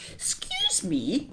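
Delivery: high, slow, polite little voice; polite child. A narrator's reading. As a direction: ask it sweetly and high, drawn out. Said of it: "said the elephant's child most politely".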